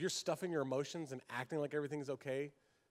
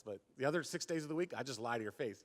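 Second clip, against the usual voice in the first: usual speaking voice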